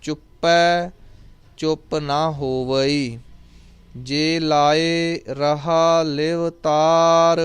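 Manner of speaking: very slow, sustained chant with long vowels; scripture recitation. Shown as a demonstration. Teacher reciting Japji for students, slow measured chant. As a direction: draw out each line on a level, held tone.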